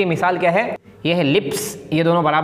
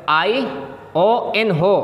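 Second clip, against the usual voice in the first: ION drawn out letter by letter; careful slow emphasis; 2 semitones higher, much slower than usual. Shown as a demonstration. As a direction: spell ION slowly for the class. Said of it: "ध्यान से सुनिए आप".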